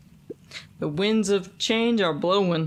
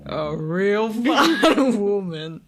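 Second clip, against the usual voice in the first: put-on laughing old man; 4 dB louder, slower than usual. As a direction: laugh through it fondly.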